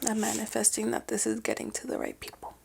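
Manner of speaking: hushed, breathy whisper. Real, not acted. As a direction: whisper it softly, heartfelt. Soft heartfelt wish to listeners.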